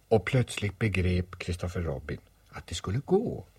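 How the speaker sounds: ordinary delivery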